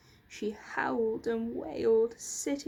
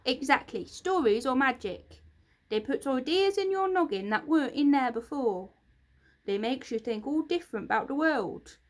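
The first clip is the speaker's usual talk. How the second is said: loud, gruff creature grumble; grumpy creaker scolding Lucy; grumpy bark; 3 semitones higher, 6 dB louder than usual. Acted. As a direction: bark it out crossly. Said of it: "barked Grunt grumpily".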